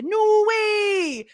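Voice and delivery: high, loud Geordie exclamation; mock Geordie delight; Geordie accent. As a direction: shout it like the Taskmaster Geordie. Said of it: "the guy from Taskmaster with the".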